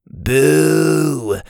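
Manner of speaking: long, drawn-out high boo; disappointed jeer. A narrator's reading. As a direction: heckle it like a let-down crowd. Boy jeers in disappointment.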